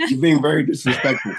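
half-shouting through laughter; loud laughing protest; laughing mock outrage. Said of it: "mock offense, laughing".

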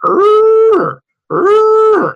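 high, loud strangled groan; strained internal groan. Retold or imitated. Man under strain groaning like inner alarm, high and loud. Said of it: "internal sound that goes".

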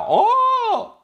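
high, loud shriek of "oh"; sudden high-pitched yell; acted scream. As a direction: cry out in startled alarm. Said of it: "él grita".

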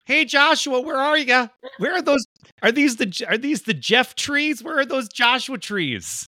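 high, sing-song Wisconsin mother calling out for trees; a high, sweet Midwest mom voice, in a Wisconsin accent, calling for Joshua. Retold or imitated. Host mimics guest's mom hunting Joshua trees, in a high Wisconsin accent. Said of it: "that's basically her".

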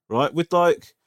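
usual speaking voice

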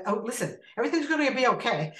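lightly voiced boss hurrying to soothe; retold reassuring boss. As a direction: imitate a boss rushing out a reassurance. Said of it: "And the boss walks in".

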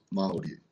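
Maori softly, with a tapped R; native Maori pronunciation. Shown as a demonstration. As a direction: repeat Maori quietly, R lightly rolled. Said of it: "how do you pronounce it".

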